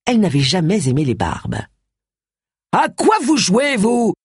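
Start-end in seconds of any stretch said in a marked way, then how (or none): 2.7-4.1 high, slow, indignant bellow. A narrator's reading. Inspector confronting a naked man, bellowing. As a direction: bellow it with contained volume. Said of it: "beugla Wilkes".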